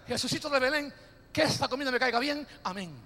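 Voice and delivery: mock quick table prayer; rushed mumbled grace. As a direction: hurry through grace like a habit.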